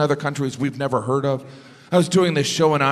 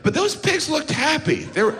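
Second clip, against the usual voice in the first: pitched way up, amazed the pigs look happy; high mock delight; 13 semitones higher than usual. Comic recalling the pig truck, high amused wonder. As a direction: squeak it out in disbelief.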